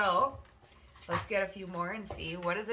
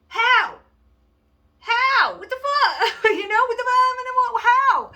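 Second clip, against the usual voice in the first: much higher and louder, squealing what the fuck; shrieking mock disbelief; 12 dB louder, slower than usual. People shriek in disbelief that everything worked out. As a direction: pretend to be flabbergasted, voice cracking up high.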